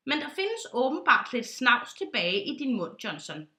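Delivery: plain reading voice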